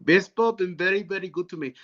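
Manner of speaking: Arabic accent on English. Shown as a demonstration. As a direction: say it like an Arabic speaker who has no P sound. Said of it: "Okay, in Arabic, say".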